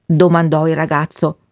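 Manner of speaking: ordinary delivery, nothing marked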